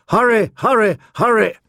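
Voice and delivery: high, falling calls; urgent trumpet cry. A narrator's reading. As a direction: cry it out like a horn call. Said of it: "a cry that said".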